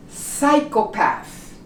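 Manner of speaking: Psychopath slowly, with no P before the S; silent P. Shown as a demonstration. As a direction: start Psychopath on S, drawn out slowly. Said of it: "You do not pronounce the P".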